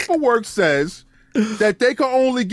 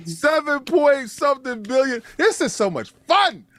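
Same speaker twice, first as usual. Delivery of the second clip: ordinary talk, nothing marked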